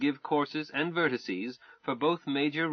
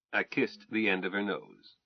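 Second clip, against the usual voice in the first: usual speaking voice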